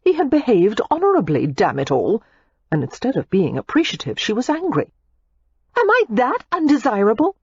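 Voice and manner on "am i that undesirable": Daisy ranting. A narrator's reading. Daisy rants at Matthew, hurt and indignant. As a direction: rant at him, hurt and indignant. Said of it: "Daisy was ranting".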